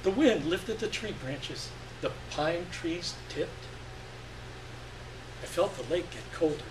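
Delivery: ordinary delivery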